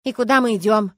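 loud, sullen question; gloomy. A narrator's reading. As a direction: ask it grumpily, with force. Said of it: "мрачно спросила я".

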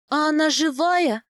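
high, loud horrified question. A narrator's reading. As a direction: ask it in sudden dread. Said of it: "с ужасом спросила я".

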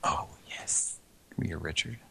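hushed unvoiced whisper. Hushed echo bit about Richard.

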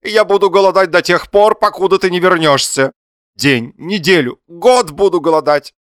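high, loud vow of defiance; wretched husband's shout. A narrator's reading. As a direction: build the threat in a theatrical cry. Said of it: "закричал несчастный муж".

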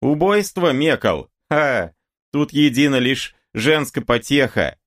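high-pitched mocking call. A narrator's reading. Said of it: "крикнул казаку".

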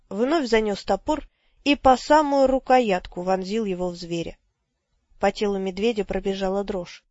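ordinary delivery, nothing marked

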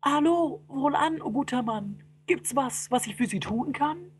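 high, nervous greeting; cowed, high-pitched offer of help; timid, faint-hearted. Acted. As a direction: pipe up politely without courage. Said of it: "doch recht mutlos klang".

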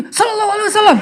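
very high, irate blessing; mock angry. Egyptian answering salawat while still angry, mock fuming. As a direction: spit out the blessing in hammed-up anger. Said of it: "yang sambil marah".

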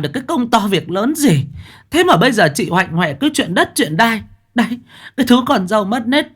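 mother-in-law bursting in to berate her son's wife, shouting; shrill scolding. Acted. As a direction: tear into the daughter-in-law at full volume. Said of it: "Bà xông vào quát lên ầm ĩ".

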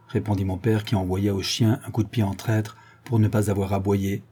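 ordinary delivery, nothing marked

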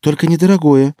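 high, loud quick caution; warning. A narrator's reading. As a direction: warn before it goes too far. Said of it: "предупредила Маша". (предупреждающе, повышенным голосом)

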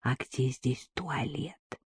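slow hushed whisper. Acted. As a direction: lean in and whisper it. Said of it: "шёпотом спросила женщина".

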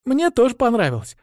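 put-on high, bright young dragon girl; smiling agreement. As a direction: chime in with a smile. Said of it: "с улыбкой поддакнула дракоша".

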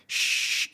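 SH with no voice at all; soft isolated SH; voiceless sh. Shown as a demonstration. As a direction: push air through a hushing 'sh'. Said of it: "es un sonido sordo".

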